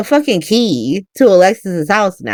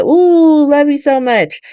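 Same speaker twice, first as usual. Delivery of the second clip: gushing; 9 semitones higher, slower than usual. Acted. Christina's gushing hug, acted quote.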